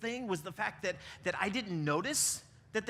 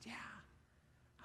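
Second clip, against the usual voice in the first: soft whispered yeah; 20 dB quieter than usual. Retold or imitated. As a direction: barely breathe the yes. Friend quietly admits hurt.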